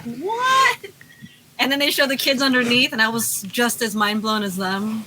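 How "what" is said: shocked what. Retold or imitated. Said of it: "I'm like".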